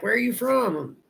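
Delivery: mock questioner, voice lifted high; imitating curious questioner. Imitating strangers asking about origin.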